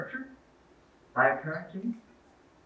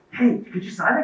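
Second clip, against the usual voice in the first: bright, higher eager request; eager ask; 8 semitones higher, 4 dB louder than usual. Retold or imitated. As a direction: ask him like an excited fan. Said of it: "He goes".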